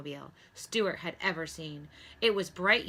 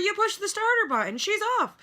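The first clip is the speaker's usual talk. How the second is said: high, loud frantic cries; rising panic fading to dismay; panicked doctor; 9 semitones higher, 5 dB louder than usual. A narrator's reading. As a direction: chase the runaway car in alarm. Doctor panics over car.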